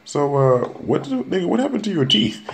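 high squeaky voice imitating a friend; high imitation of the girl asking about the teeth; mocking Jessica. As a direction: mimic her squealing question. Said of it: "Jessica was like".

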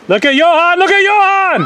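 very high, loud thrilled cheer; excited shout. Real, not acted. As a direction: point everyone to his run, thrilled. Parent thrilled at son's run.

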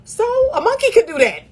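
high, laughing put-down; laughing scorn. Real, not acted. Coach laughing at men who just like posts, pitched high. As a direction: laugh it off as pathetic.

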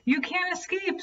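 high, menacing crow screech. Acted. The crow to Katisha, a high screeching threat. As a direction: loom over the prey with a shrieking threat. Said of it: "screeched the crow to Katisha".

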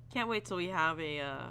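ordinary talk, nothing marked

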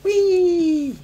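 very high, loud squeal; shrieking cartoon henchman; excited minion. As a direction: squeal it to your master. Voices Bowser's minion in game.

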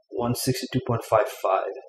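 ordinary talk, nothing marked

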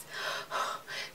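soft breathy sigh; dreamy sigh; longing sigh. Real, not acted. Longing sigh at nail polish.

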